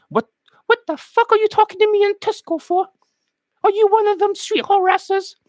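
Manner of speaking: high falsetto mock Londoner; offended woman. Woman confronting him in Tesco, high mock falsetto. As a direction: challenge him in a piping falsetto.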